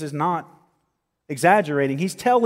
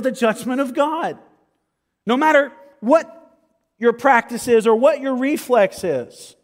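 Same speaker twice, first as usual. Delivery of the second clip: raised, ringing pulpit voice, higher and louder; preaching at full force; 8 semitones higher, 6 dB louder than usual. Acted. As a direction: drive it home to the congregation.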